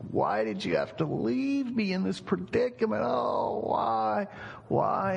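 high-pitched pretend crying, wailed out; mock wailing. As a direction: act out an anguished graveside wail, drawn-out and hammy. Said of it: "wailing and crying, and he was going".

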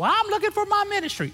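very high, silly put-on voice; mock seeker. Preacher parodying someone hunting for a ministry, silly high voice. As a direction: squeak it out in parody. Mocking Christians seeking a ministry.

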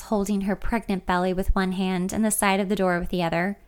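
ordinary delivery, nothing marked

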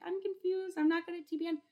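high, whiny confused student. Retold or imitated. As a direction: play the lost student begging for help. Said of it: "people in the comments were like".

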